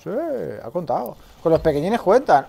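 ordinary talk, nothing marked

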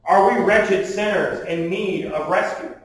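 ordinary talk, nothing marked

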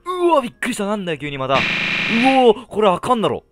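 startled scream, very high; hit hard crying out. Real, not acted. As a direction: yelp in real fright. Jump scare in horror game.

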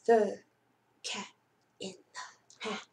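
dropping to a breathy whisper on "in the Hat"; hushed title. Acted. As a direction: hush it like a secret for the kids. Dramatic title read before story.

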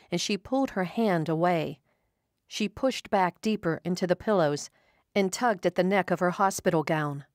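ordinary delivery

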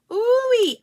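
high, loud ooh-wee; delighted squeal. Real, not acted. Delighted at the result.

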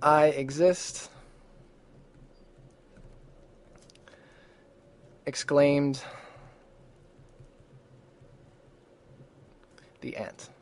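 slow dictation with very long pauses between phrases; dictated example sentence. Shown as a demonstration. Teacher giving students a sentence for note cards, slow dictation. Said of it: "This is the sentence that I like".

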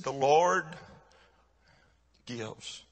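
halting, with long pauses, fading very soft; retold weeping father. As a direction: struggle quietly to get each word out. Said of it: "his lips was trembling and he was weeping".

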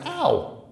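high pained yelp; boy yelps as his ear is pinched; sharp ow. A narrator's reading. Ear squeezed by policeman.

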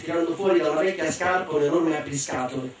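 ordinary delivery, nothing marked

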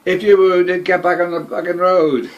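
loud barked order from the sergeant; retold shout. Sergeant shouting an order at the wounded German, loud. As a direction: yell it across the dark at the wounded. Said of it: "And he shouted".

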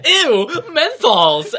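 hammed-up disgusted yelp, high, loud and slow; ridiculous non-casual. Retelling the cigarette scene, ridiculous non-casual mock disgust. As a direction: overplay a snooty cry of disgust. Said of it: "in the most ridiculous, non-casual way".

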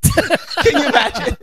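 laughing high while asking. Real, not acted. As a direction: laugh through the question. Laughing at the live stream idea.

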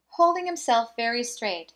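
ordinary delivery, nothing marked

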